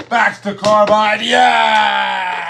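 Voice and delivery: loud excited cheer; triumphant shout. Real, not acted. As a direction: shout it as the shot drops. Cheering a game winner.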